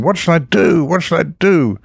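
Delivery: put-on high, panicked cry of a desperate young prisoner; frantic shouting. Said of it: "he kept shouting".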